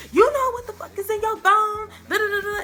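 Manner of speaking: high, loud outraged yell; furious accusation. Retold or imitated. Confronting her boyfriend about his phone, outraged yell. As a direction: blast him about what's on the phone.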